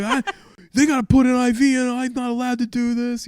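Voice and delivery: high, loud imitation of a New Yorker; heated argument. New York doctor going at the Philly guy, high mock arguing. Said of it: "they're going at it".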